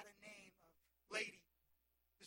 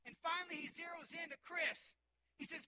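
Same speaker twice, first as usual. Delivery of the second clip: loud shouted preaching; full-force preaching; 5 semitones higher, 3 dB louder than usual. Acted.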